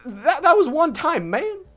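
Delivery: loud, high, slow spluttering; exasperated protest. Acted. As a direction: protest in a high, embarrassed outburst. JonTron protests to his bird.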